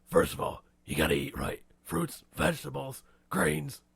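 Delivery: forceful growl, deliberate pacing; Batman. Retold or imitated. Batman giving diet advice, gruff growl. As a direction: growl each item out like a grim hero. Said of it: "what's your advice, Batman?".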